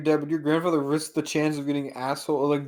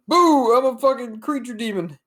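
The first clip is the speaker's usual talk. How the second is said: high shrieking boo; spooky demon; 8 semitones higher than usual. Retold or imitated. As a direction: jump out and yell boo. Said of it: "they're like".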